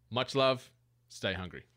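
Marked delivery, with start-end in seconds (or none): none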